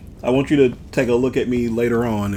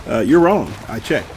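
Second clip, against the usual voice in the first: usual speaking voice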